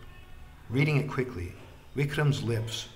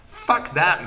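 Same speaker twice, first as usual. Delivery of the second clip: angry outburst; 7 semitones higher, 11 dB louder than usual. A narrator's reading. Haggling over the bribe.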